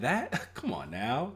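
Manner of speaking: ordinary delivery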